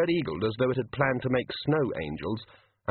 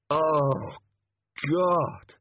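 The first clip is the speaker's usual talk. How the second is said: groaning; 5 semitones higher than usual. A narrator's reading. Clutching heads.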